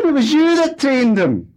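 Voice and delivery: very high, loud, shrill harangue; wife screaming at husband in row; angry shouting. Acted. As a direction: yell him down with scorn.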